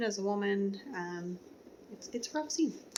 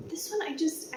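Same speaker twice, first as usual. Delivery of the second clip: ordinary talk, nothing marked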